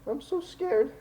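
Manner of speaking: put-on high-pitched small child voice; pleading little girl. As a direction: beg for help as a frightened girl. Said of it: "she's just crying and".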